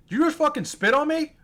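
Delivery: high-pitched retold disbelief; incredulous challenge. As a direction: re-enact an affronted, disbelieving question. Retold confrontation after being spat at.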